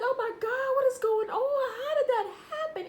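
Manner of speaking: high, loud mock disbelief; retold shocked outcry. Beside her fallen friend, loud high-pitched mock shock. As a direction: cry out in exaggerated alarm. Retelling own shocked reaction to fall.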